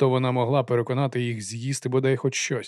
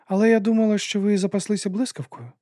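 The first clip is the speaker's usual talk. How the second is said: raised objection; 9 semitones higher than usual. A narrator's reading. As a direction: object in a much higher voice. Lady challenges the clockmakers.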